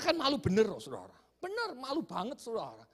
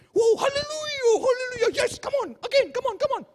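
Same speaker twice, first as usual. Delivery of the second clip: American-style praise; 14 semitones higher, 11 dB louder than usual. Retold or imitated. Pastor next to him praising, loud ecstatic cries. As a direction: wail praise like an American revival. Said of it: "sudah ala Amerika".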